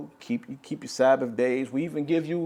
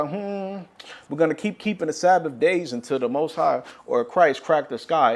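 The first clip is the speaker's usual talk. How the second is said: ordinary talk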